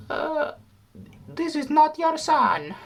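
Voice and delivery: very high, slow put-on voice; very high hammed-up female voice; high lady voice. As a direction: drawl the reveal in a very high character voice. Chinese reveals truth about son.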